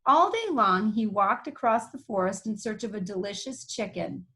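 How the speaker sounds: plain reading voice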